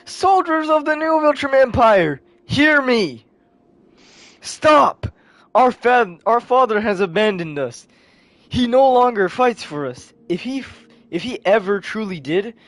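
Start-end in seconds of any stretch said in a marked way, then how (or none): 0.0-10.1 high, loud, impassioned proclamation; acted youthful battle oratory; rallying speech. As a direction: address an army as a young leader. Thrag's child rallies the soldiers.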